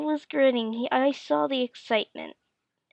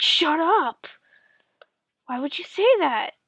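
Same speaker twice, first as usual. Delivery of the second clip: raised pitch, loud pained retort; imitated upset dad; 5 semitones higher, 5 dB louder than usual. Dad reacts to kid saying he died, mock upset outburst. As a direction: snap back as a wounded dad, playfully.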